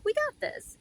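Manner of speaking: very high, chirpy reassurance; cheery spirit. Retold or imitated. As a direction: sing it out lightly like a carefree fairy. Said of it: "my spirit's like".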